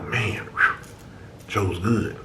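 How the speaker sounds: soft, breathy relief, a quiet whew; relieved sigh. Retold or imitated. Talking himself through a good choice, soft relieved sigh.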